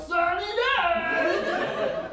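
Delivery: very high, drawn-out mock cheer; exaggerated sarcasm. Shown as a demonstration. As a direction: stretch it out in high, over-the-top sarcasm. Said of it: "I'm supposed to be sarcastic".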